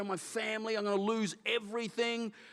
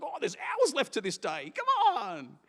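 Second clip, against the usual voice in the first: light mock-eager voice jumping much higher; mock eager self; 6 semitones higher, 4 dB louder, slower than usual. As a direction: toss it off as a hammed-up inner voice, rising high. Mocking his own restless thought.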